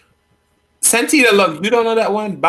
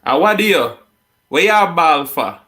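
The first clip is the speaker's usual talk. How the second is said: higher, louder Jamaican mother scolding her crying son; 5 semitones higher, 5 dB louder than usual. Retold or imitated. A mother scolds her crying son, loud Jamaican scolding. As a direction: scold him sharply for crying. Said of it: "She'll say".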